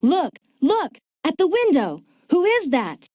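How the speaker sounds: high, startled and urgent; urgent alarm. Acted. Partner spots someone at the window, startled and urgent. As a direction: alert your partner with a jolt of alarm.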